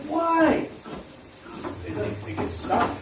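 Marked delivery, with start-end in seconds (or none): 0.1-0.7 high, loud squeak of disbelief; a sudden squeaky outraged why; mock surprised why. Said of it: "I'm like".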